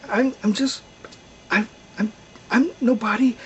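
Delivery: timid stammer. A narrator's reading. Old man answers the shepherds who yelled, stammering timidly. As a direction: stammer out a meek excuse.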